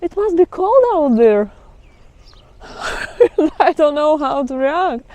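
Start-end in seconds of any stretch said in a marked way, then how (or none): 0.0-1.5 a high, slightly louder put-on voice of a clueless local; lightly voiced mock naive asker; mock naive question. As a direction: play the cliché asker, a bit silly. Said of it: "their first reaction to this reply is".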